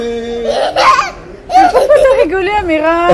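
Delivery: asking through laughter; words broken by real laughs; laughing. Real, not acted. Laughing at giggling child.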